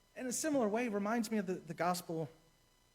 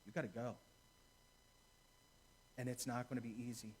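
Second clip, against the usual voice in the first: solemn warning; 6 semitones lower, 8 dB quieter, faster than usual. Shown as a demonstration. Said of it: "Second peace be with you says".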